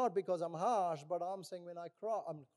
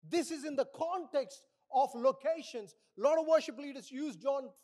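loud, raised-pitch sermon delivery; forceful preaching; 5 semitones higher, 7 dB louder than usual. Real, not acted. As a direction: proclaim the scripture to the whole congregation. Preaches and reads scripture forcefully.

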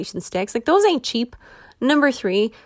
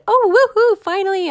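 high, loud cheer of delight; sudden burst of joy, much higher and louder; excited woohoo; 11 semitones higher, 5 dB louder than usual. Retold or imitated. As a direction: whoop it up like a big win. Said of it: "And I'm getting so excited".

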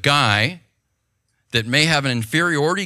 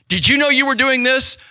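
higher, louder accusing cop question; mock stern cop; 10 semitones higher, 5 dB louder than usual. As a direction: play the cop at the window, catching him out.

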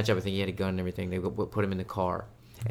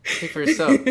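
higher, louder mock-offended comeback; indignant retort; 9 semitones higher, 10 dB louder than usual. Answering the dumb-kid jab, a loud mock-offended retort. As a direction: throw it back at her, mock offended and loud.

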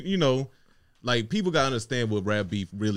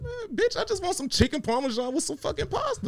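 exasperated outburst. Retold or imitated. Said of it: "I was like".

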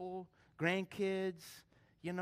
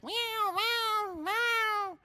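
high little kitten mews; kitten meows; 7 dB louder, much slower than usual. Retold or imitated. Pastor imitates kittens climbing from the box, high meows. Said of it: "those cats".

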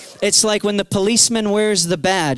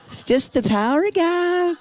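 much higher, lighter voice of the praying woman; praying woman repeats her answer, higher; high repeated reply; 10 semitones higher than usual. Retold or imitated.

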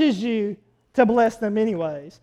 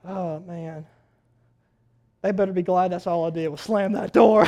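low grumble; 7 semitones lower, 5 dB quieter than usual. Retold or imitated. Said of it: "She says".

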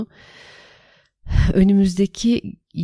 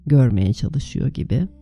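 guided meditation; 3 dB louder than usual. Acted. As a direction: lead them calmly into the meditation. (sakin rehberli meditasyon sesi)

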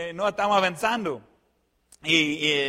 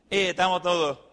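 normal talking voice

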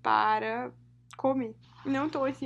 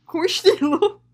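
amused disbelief; 7 semitones higher, 8 dB louder than usual. Real, not acted. Amused echo of a phrase.

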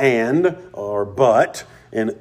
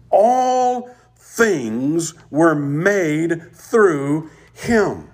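proclaiming; 5 semitones higher, much slower than usual. Acted. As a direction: proclaim it to the congregation, slowly. Preacher proclaiming scripture.

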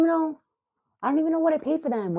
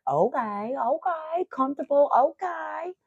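high sing-song rising okays; mock-eager approval, pitched way up; convincing herself; 10 semitones higher than usual. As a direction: talk yourself into it, each okay climbing high. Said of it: "And I'm like".

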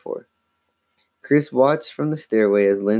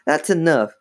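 higher-pitched scolding; mother's raised voice; mild shout; 6 semitones higher than usual. A narrator's reading. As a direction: put a stop to it sharply. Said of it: "Missus Paulwell shouted".

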